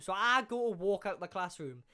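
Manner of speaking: plain reading voice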